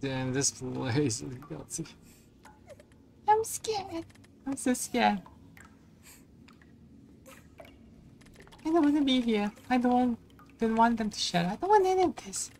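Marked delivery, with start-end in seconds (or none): 3.3-12.5 scared. Acted. Scared voicing of game character.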